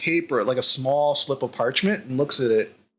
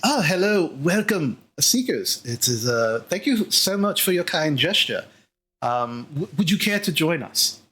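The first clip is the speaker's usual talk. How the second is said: courteous green dragonborn; 2 semitones higher than usual. Acted. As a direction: play a gracious, slightly fussy scholar. Game master plays green dragonborn Bookworm.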